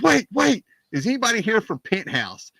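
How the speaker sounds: high, eager shout over the press; excited John Candy. Retold or imitated. John Candy calling out to the reporters, high and excited. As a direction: call out to reporters, urgently. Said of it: "he goes".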